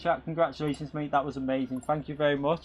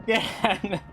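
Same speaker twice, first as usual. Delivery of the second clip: relieved laughter. Real, not acted. Laughing after win.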